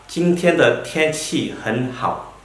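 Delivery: Jingtian de tian qi hen hao, each syllable drawn out slowly; very slow model sentence. Shown as a demonstration. Teacher models weather sentence for students, very slow. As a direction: read it out at half speed for learners. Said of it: "Contohnya berupa".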